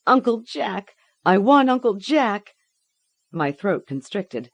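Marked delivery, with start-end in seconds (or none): 0.1-2.5 high, loud tearful plea; crying child. A narrator's reading. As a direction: cry out for help.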